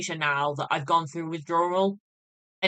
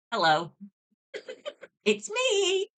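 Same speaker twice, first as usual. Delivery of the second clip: sing-song hello, then a much higher laughing squeak; mock cheery visitor; 12 semitones higher, slower than usual. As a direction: play the pest popping up at reception, laughing.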